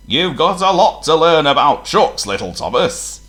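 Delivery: firm, loud boss voice; stern boss. A narrator's reading. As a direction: lecture the little engine. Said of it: "the Fat Controller told him".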